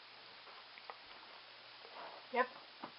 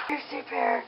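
ordinary delivery

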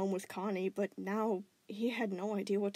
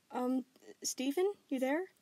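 put-on higher, slow unsure girl voice; hesitant girl; 5 semitones higher than usual. Connie asks on the phone if he is there, hesitant. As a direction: ask gently, unsure.

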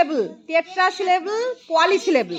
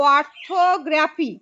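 Orthography slowly, split syllable by syllable; broken into syllables; slower than usual. Shown as a demonstration. Teacher showing how to divide a word, orthography in slow syllables. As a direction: draw out each syllable of orthography separately. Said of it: "এটাতে কেমন করে ভাঙবো?".

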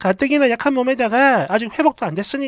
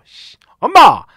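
chasing her out; 4 semitones higher, 9 dB louder than usual. Retold or imitated. Husband furiously throwing his mother out, loud. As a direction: drive her out the door. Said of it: "남편이 집 밖으로 쫓아버렸어요".

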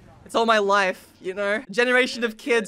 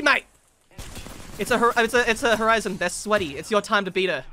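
usual speaking voice

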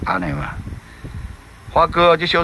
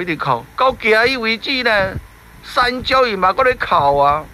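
ordinary delivery, nothing marked

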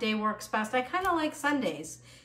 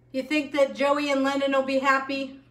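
usual speaking voice

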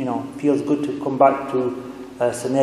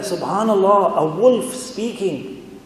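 higher-pitched mock astonishment, drawn out at the end; imitating someone stunned; amazed; 6 semitones higher, slower than usual. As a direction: marvel slowly as the retold shepherd. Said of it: "So he was amazed".

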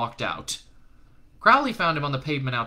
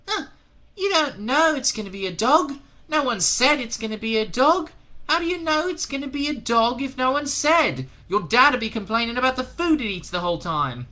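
put-on very high, loud boyish scoff; scoffing kid; 13 semitones higher, 5 dB louder than usual. A narrator's reading. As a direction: sneer it like a doubting boy. Second boy scoffing.

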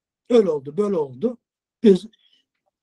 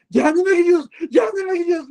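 high mock shout in Turkish; retold shout; 10 semitones higher than usual. Driver repeating his taunt in Turkish, high mock shout.

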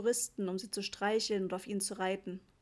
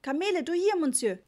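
even louder, pushier hawking; insistent trader; 7 semitones higher, 7 dB louder than usual. A narrator's reading. Trader repeats his pitch at Papa, more insistent. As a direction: push harder, hand held out. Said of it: "sagte der Mann eindringlich".

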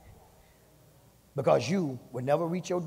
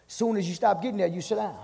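higher preaching build; 4 semitones higher than usual. Acted. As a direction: press on each phrase. Preaching at full force.

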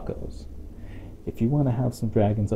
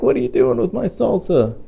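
high, raised squeal of outrage; outraged dragon; 12 semitones higher, 3 dB louder than usual. Acted. Dragon protesting as the salsa gets buried, high and indignant. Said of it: "He's like".

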